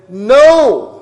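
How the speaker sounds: a loud, high, final No; emphatic no. Retold or imitated. God refusing Abraham's plea, a loud emphatic No. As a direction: slam the door with No. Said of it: "I don't know how you could be any more emphatic".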